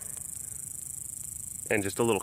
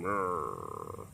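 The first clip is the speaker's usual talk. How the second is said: imitated growl; 10 dB quieter than usual. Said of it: "She was going".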